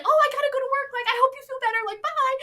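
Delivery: high, loud, bubbly farewell; chirpy goodbye. Retold or imitated. Heroine leaving the cute guy for work, chirpy and high. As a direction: sing out a breezy, high-pitched exit line.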